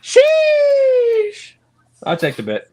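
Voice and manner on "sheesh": very high, long held sheesh; high drawn-out sheesh. Real, not acted. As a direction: stretch out a piercingly high "sheesh". Long high exclamation at bet.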